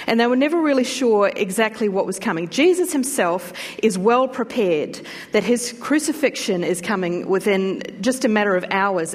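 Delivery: ordinary talk, nothing marked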